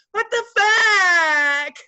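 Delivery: high, loud, slow drawn-out outrage; mock outraged girl. Imitating the girl whining at the heckler. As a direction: stretch out the shocked whine.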